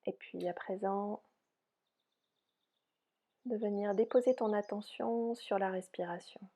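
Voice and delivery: soft, unhurried yoga nidra guidance; softly spoken relaxation voice, spacious phrasing; guided meditation. Real, not acted. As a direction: guide them gently into rest, with long pauses.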